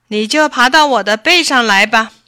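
loud, hearty tiger voice; confident tiger. A narrator's reading. Tiger invites the fox onto its back, confident. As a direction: project it like a big tiger.

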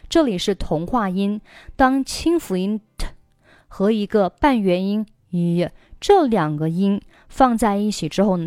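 ordinary talk, nothing marked

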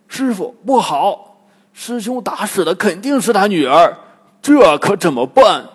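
loud, high, alarmed; panicked Bajie. A narrator's reading. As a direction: blurt out in a panic. 八戒 panics to master. (八戒惊慌大叫)

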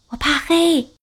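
high, small scared cub voice; a timid little cub afraid of the dark; little bear voice. Acted.

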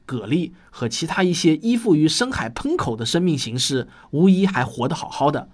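ordinary talk

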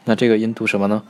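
usual speaking voice

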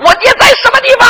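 high shouted demand for answers; angry interrogation. Acted. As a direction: grill mother at a high pitch. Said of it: "我当时我就恼了". (恼怒地高声逼供)

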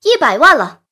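loud, worked-up auction call; excited auctioneer. A narrator's reading. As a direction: announce the bid with rising excitement. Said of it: "拍卖师的情绪有些亢奋了".

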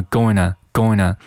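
"going" run together as "goenna". Shown as a demonstration. Teacher speeds up the linking, "going" said as a quick "goenna". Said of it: "那读快了就变成了".

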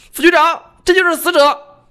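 a junior cop blurting out a shock discovery; startled policeman. A narrator's reading. Policeman announcing the victim's identity to his boss, high and loud. Policeman reports the dead man.

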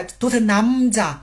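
男家 with a clear final n, slowly; clear n ending. Shown as a demonstration. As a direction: stretch 男家 out and sound the n. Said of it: "一定要把这个木读出来".

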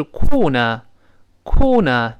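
British "corner" without r, slow; British pronunciation. Shown as a demonstration. Teacher recalling a word from book three, British pronunciation. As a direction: model British "corner" as kaw-nuh. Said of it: "英式发音是corner".

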